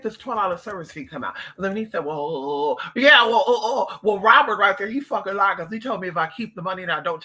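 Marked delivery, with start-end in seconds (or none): none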